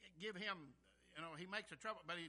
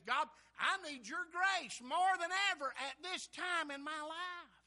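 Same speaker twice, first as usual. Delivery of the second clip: raised, loud plea to God; pleading prayer; 9 semitones higher, 10 dB louder, slower than usual. Acted. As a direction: cry out urgently for help. Acts out David's urgent prayer.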